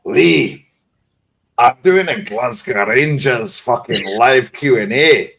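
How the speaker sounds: doing a broad Glaswegian mate shouting; Scottish accent, hammed-up and loud; Glaswegian impression. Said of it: "And he's like".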